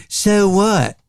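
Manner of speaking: So what with a tight North Carolina O, pitched high; Southern drawl on So what, tight O; North Carolina accent. Shown as a demonstration. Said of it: "In North Carolina, they say".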